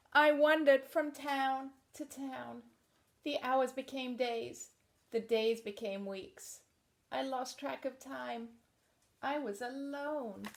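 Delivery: picture book narration; reading to children. Acted. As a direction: read it out to a room of kids.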